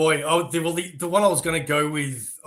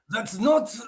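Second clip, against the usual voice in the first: put-on higher-pitched Russian-accented protest; 6 semitones higher than usual. The guy cut off mid-protest, Russian accent. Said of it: "if I have to do a Russian accent".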